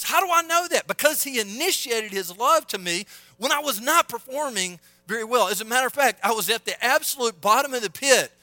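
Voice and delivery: high, loud pulpit exclamation; impassioned preaching. Real, not acted. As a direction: drive it home at full preaching volume. Preaches at full force.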